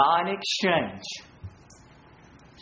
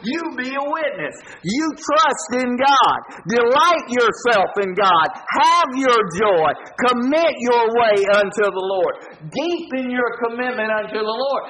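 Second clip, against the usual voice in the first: full-force preaching; 6 semitones higher, 4 dB louder than usual. Real, not acted. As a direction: drive each command home to the congregation. Preacher exhorting congregation at full force.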